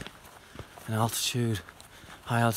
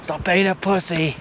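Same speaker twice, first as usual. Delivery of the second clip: higher, louder mother's jab; scolding mum; 6 semitones higher, 5 dB louder than usual. Retold or imitated. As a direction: snap it like a blunt mum. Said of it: "That's what my mum would say".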